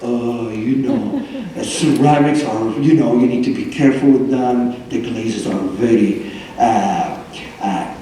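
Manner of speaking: low, drawn-out imitation of a ceramicist; slow deep-voiced teacher. Ceramics teacher warning the class about glazes, slow deep voice. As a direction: drop the pitch and drag out the words, imitating him. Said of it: "He has a deep voice".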